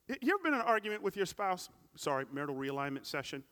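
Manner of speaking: hammed-up exasperation over an old fight; animated preacher banter; high, loud comic preaching. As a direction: play up the absurdity for the crowd. Preaching humor at full force.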